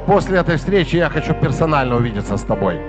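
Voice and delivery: ordinary talk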